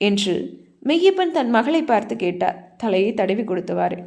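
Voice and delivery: plain reading voice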